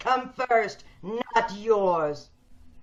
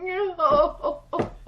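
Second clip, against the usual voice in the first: despairing laugh; 5 semitones higher than usual. Acted. Despairing patient breaks down.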